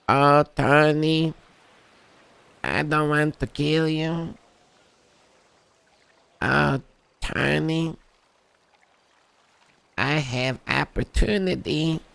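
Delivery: high, slow, drawn-out imitation of Earl; Earl impression. Tony mimicking Earl's job pitch, high and slow. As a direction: stretch each word out high and slow like Earl. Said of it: "That's how he sounded like".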